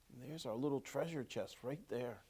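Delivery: plain reading voice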